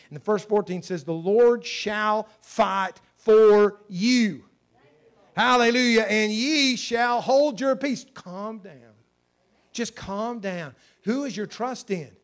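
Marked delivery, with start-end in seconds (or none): 1.0-4.4 slow, loud booming proclamation; booming preacher proclamation; proclaiming. Acted. Preacher proclaiming scripture verse. 5.3-8.0 loud, higher praise cry; fervent loud hallelujah; fervent exclaim. Real, not acted. Preacher exclaiming during scripture. 8.1-10.8 soft, slow calming words; soft reassuring calm down; soothing. Acted. Preacher soothing the fearful.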